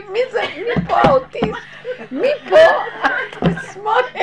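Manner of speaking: high, loud words through laughter; laughing loud at the scuffle; laughing exclamation. Real, not acted. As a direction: cry out in disbelief, cracking up. Her stunned outburst, laughing.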